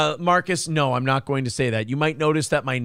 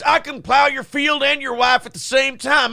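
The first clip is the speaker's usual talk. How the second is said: Dale Peterson voice; 10 semitones higher, 3 dB louder than usual. Acted. Reading viewer's Dale Peterson quote.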